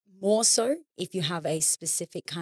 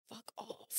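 breathy whispered brush-off; whispered fuck off; 4 semitones higher, 20 dB quieter than usual. Retold or imitated. Fed-up person whispering at a pushy helper. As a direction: hiss it under your breath. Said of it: "And it gets like".

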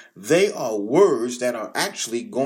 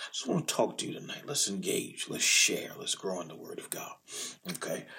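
soft, quick near-whisper; hushed aside; 5 semitones lower, 7 dB quieter, faster than usual. Real, not acted. Pastor quietly inviting the congregation to engage, hushed. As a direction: lean in and speak just above a breath.

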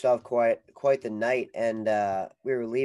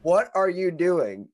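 loud, high, drawn-out question; slow, incredulous retold shout; exasperated disbelief; 7 semitones higher, 8 dB louder, slower than usual. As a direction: stretch each word in mock bewilderment. Acted bewildered reply to stranger.

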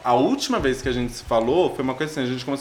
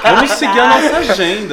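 loud high mock voice through laughter; 9 semitones higher, 10 dB louder than usual. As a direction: laugh while parodying a planner. Mocking quote while laughing. (imitação zombeteira rindo)

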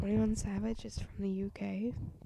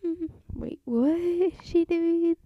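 higher, louder, drawn-out mock disbelief; puzzled reaction; 7 semitones higher, 7 dB louder, slower than usual. He reacts to Seven Rings, baffled mock disbelief. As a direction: imitate his baffled reaction, slow and loud. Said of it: "he was kinda like".

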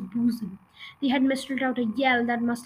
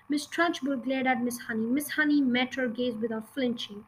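ordinary delivery

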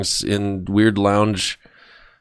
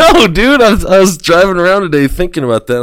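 excited; 10 semitones higher, 7 dB louder than usual. Real, not acted. Excited over band idea.

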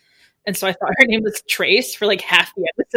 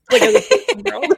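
laughing; 11 semitones higher, 5 dB louder than usual. Real, not acted. Laughing through the remark.

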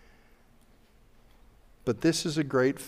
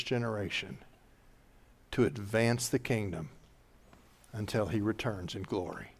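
closing prayer; 4 dB quieter than usual. Real, not acted. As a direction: bless them quietly in prayer.

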